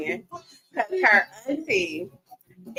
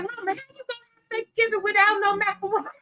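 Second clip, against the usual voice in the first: imitating daughter; 11 semitones higher, faster than usual. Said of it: "She was like".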